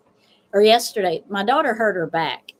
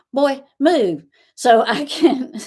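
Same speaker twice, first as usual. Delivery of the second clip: calling out; 5 semitones higher, 6 dB louder, slower than usual. Retold or imitated. Calling grandson off the ladder.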